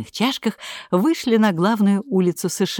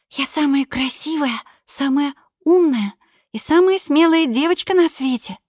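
put-on high child's voice reciting proudly; little girl voice; 9 semitones higher than usual. Girl recites Idgie's lesson.

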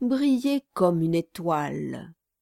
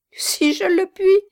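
put-on a quavering old man's reply, higher and louder; shaky old gravedigger voice; trembling voice; 12 semitones higher, 7 dB louder than usual. A narrator's reading. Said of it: "d'une voix tremblante".

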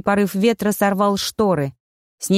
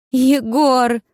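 higher, louder pleading cry; plaintive plea; 5 semitones higher, 3 dB louder than usual. A narrator's reading. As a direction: beg him for help. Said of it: "жалобно взмолилась я".

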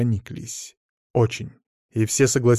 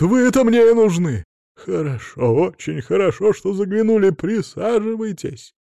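high, gushing, louder welcome; over-eager welcome; 8 semitones higher, 4 dB louder than usual. A narrator's reading. Director welcoming the very man he needs, over-eager. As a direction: fuss over a guest far too kindly.

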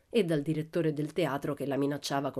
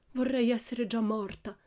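high, moaning lament; 6 semitones higher than usual. A narrator's reading. Countess on stage wishing herself dead, moaning. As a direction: groan it out theatrically. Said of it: "gemette la contessa Aurora".

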